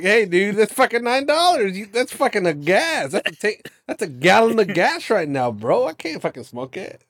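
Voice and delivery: loud, high retold rant at the cashier; mock rant. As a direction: rant it like you're outraged at the price. S0 retells ranting at Pedro.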